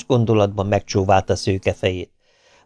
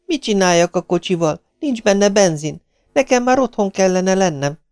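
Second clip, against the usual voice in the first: complaining; 10 semitones higher than usual. A narrator's reading. Stranded girl complains to the man.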